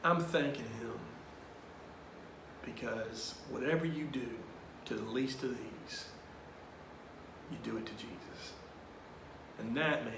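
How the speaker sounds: low, soft, measured priest; quiet, sincere retold counsel; Father Tim. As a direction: explain gently with long pauses. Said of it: "he looks at me and he goes".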